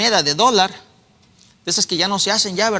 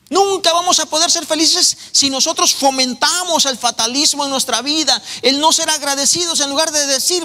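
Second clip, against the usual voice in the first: fervent preaching; 7 semitones higher than usual. Acted. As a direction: rise up and press the point. Preaching builds to full force. (predicación fervorosa, aguda y fuerte)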